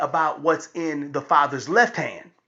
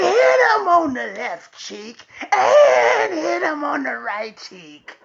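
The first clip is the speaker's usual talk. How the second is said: high, stretched-out shout; frenzied egging on; 7 semitones higher, 4 dB louder, slower than usual. Retold or imitated. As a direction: imitate an excited child yelling for blood. Daughter eggs father on, acted out.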